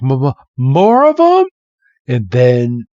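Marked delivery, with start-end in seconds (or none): 0.0-1.5 high, stuttering, panicked squeak; stammering fright. Retold or imitated. Red sees more Pokemon surround him, stammering in fear. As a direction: stammer out the words in mock terror, pitched up. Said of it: "He's like".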